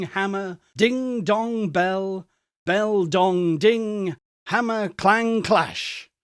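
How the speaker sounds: plain reading voice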